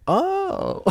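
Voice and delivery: a sudden high little oh; surprised oh. Retold or imitated. Stoned guy realizing his buddy's point, surprised high oh. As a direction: catch on all at once, startled.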